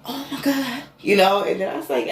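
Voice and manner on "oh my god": high, thrilled oh my God; reliving the moment he felt the answer; awed exclamation. Retold or imitated. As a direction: gasp it out in wonder. Retelling awe at God's answer.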